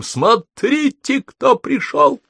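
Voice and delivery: high, slow, drawn-out joyful cry; excited shout. Acted. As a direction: call out the news with delight. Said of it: "с воплем".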